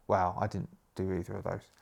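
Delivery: ordinary talk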